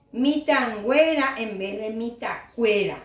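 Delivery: Mitangüera with a nasal ngüe, set against mitacuera with a plain k; nasal form mitangüera over mitacuera; nasal ngüe suffix. Shown as a demonstration.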